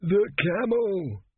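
slow, high, strained croak. A narrator's reading. Dazed traveller seizes his vocal cords to speak, croaking. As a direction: force it out as a drawn-out croak. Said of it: "and croaked".

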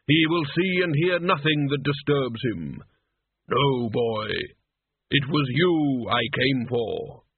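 slow, measured voice of Death; solemn Death. Acted. Death telling the boy whom he came for, slow and solemn. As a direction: intone each phrase slowly and gravely.

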